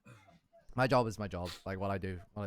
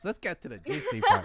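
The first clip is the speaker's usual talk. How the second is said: high excited giggly voice; laughing; 13 semitones higher, 7 dB louder than usual. Real, not acted. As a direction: laugh through the words, eager for gossip. Excited tease about Twitch gossip.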